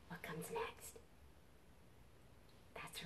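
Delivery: soft whispered question; whispering. Real, not acted. Unvoiced whisper.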